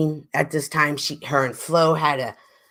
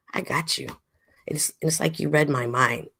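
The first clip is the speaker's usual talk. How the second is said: soft whispered aside; 6 dB quieter than usual. Real, not acted. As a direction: whisper it to chat.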